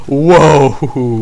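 loud, drawn-out whoas with nervous laughter; startled whoa. Real, not acted. Player reacting to a game scare, startled whoa. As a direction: cry out and laugh at the shock.

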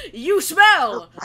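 put-on childish yell. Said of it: "just like him going".

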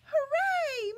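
Hooray shouted high and loud; cheer. Acted. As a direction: cheer the puppy's rescue at full voice. Puppy rescued, cheering.

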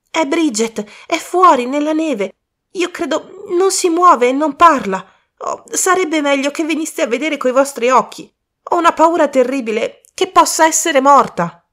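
a worried youth bursting in; young man Colin. Acted. Colin reports body in snow.

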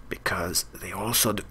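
whispered joke aside; whispering. Real, not acted. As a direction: hush it like a secret. Cheeky aside continues.

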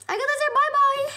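high loud bye-bye; singsong goodbye. Real, not acted. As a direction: wave them off gleefully. Singsong farewell to viewers.

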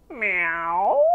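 drawn-out meow sweeping up at the end; cat meow. A narrator's reading. Storyteller voicing the dog for children, swooping meow. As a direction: stretch out a meow that swoops upward. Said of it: "let out a".

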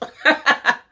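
high, loud giggle. Real, not acted. She giggles after her slogan, high and loud. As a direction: giggle at yourself.

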